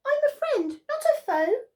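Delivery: high, cheerful little mouse; a friendly mouse cheering up the lion; high mouse voice, playful. Acted. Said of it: "Mouse peeped".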